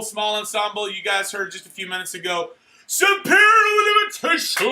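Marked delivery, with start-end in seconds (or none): 2.9-4.7 announcer shout. Pretend. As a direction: belt out the award like a ring announcer. Announcing the award to cheers.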